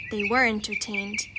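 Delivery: normal talking voice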